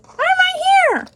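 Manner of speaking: very high, squeaky puppet voice; little toy voice. Pretend. Pandy answering when looked for, high toy voice. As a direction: pipe up high as a tiny toy.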